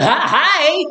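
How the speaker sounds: sarcastically sweet hello to get noticed; hammed-up falsetto hello; mock sarcastic greeting. Mock greeting to flirting cashier.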